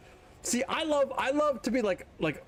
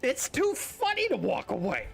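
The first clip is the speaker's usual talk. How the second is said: ordinary talk, nothing marked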